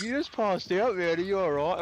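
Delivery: a mate rushing over after the faint; worried friends. Retold or imitated. Friends check on him after fall.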